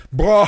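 a high, loud puppet guffaw; cartoon monster laugh done on the spot; Blob laugh. Shown as a demonstration. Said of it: "'cause I can do his laugh".

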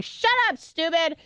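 very high-pitched mock outburst; high squawked retort; acted snapping back. Snapping back at sister, retold.

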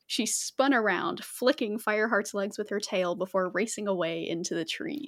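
ordinary talk, nothing marked

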